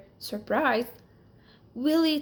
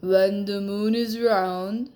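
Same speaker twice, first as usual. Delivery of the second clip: a magic lizard setting its condition; 5 dB louder, slower than usual. Acted. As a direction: speak like a wise old magic lizard.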